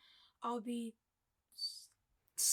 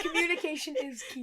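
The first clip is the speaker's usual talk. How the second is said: laughing; 4 semitones higher, 7 dB louder than usual. Real, not acted. Repeats phrase while laughing.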